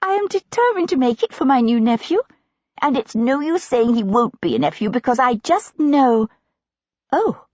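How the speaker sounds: put-on high, bright girlish gushing; eager younger sister. Younger sister bursting in with a pattern book, high and gushing. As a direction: gush over the pattern book.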